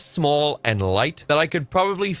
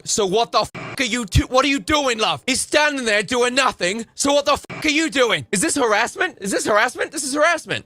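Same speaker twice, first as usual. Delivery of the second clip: mock outraged man; 8 semitones higher than usual. Streamer voicing the idle man, high mock outrage.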